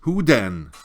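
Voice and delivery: loud despairing cry; boy crying out in despair to the turtle. A narrator's reading. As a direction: shout it out in despair. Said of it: "cried Atreyu in despair".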